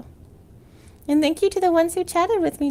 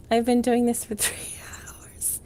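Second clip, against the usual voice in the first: whisper; 5 semitones lower, 6 dB quieter than usual. Real, not acted. Whispered then shouted.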